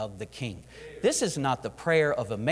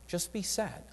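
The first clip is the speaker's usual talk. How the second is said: much softer, hushed reply of God; gentle divine voice; 3 semitones higher, 8 dB quieter, slower than usual. Acted. The Lord quietly telling Nehemiah just to be sad. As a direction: say it softly, like God calmly insisting.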